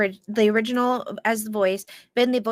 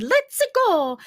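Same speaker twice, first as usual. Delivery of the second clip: higher Italian American "Let's go"; Italian American accent; 6 semitones higher than usual. Retold or imitated. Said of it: "but then he said".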